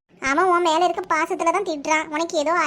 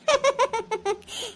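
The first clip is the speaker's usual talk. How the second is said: short high chuckle; a string of light chuckles; laugh; 4 semitones higher, 4 dB quieter than usual. Acted. Friend laughs at the others' plight, chuckling.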